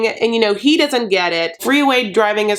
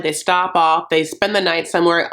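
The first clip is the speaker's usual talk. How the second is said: normal talking voice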